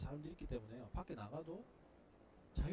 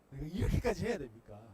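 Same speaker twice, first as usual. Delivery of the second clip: loud complaint; 11 dB louder than usual. Pretend. Spouse complaining to God.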